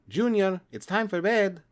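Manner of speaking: Mom Asparagus. Acted. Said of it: "the familiar Mom Asparagus saying".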